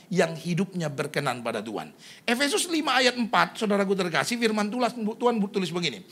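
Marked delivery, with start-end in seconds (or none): none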